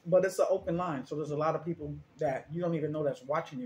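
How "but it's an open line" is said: ordinary talk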